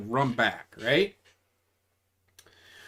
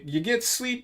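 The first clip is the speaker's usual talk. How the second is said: ordinary delivery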